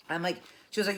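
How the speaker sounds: usual speaking voice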